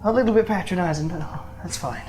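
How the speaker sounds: Nathaniel. Acted. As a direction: object quickly, then let it go. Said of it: "And Nathaniel's like".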